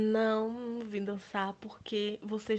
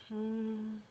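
ordinary delivery

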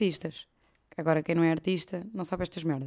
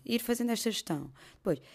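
normal talking voice

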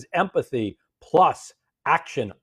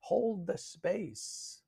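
soft slow guidance; 6 semitones higher, 11 dB quieter, much slower than usual. Shown as a demonstration. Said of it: "coach demonstrates holding space".

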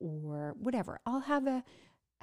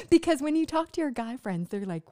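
ordinary delivery

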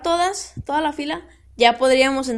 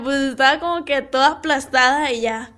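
usual speaking voice

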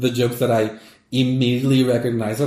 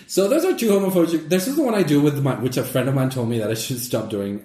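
normal talking voice

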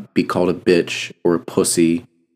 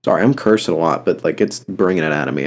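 ordinary delivery

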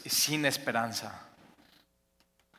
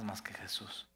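whispered, much softer; 4 semitones lower, 13 dB quieter than usual. Real, not acted. Preacher closing a point to the congregation, whispered. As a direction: drop to a hushed whisper. Intimate climax of sermon point.

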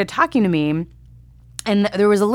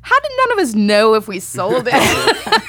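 laughing; 4 semitones higher, 4 dB louder than usual. Real, not acted. Laughing at the confusion.

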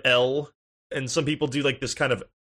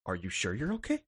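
quiet concerned voice; 7 semitones lower, 11 dB quieter than usual. Retold or imitated. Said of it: "he just goes".

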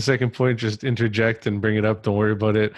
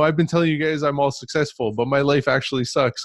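higher-pitched imagined online announcement; mock confession; 5 semitones higher than usual. Host imagines confessing to his audience online, mock-earnest. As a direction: blurt it to followers, lightly acted.

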